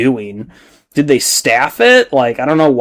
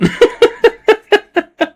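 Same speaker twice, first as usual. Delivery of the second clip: long high laugh. Real, not acted. Laughing at the meme.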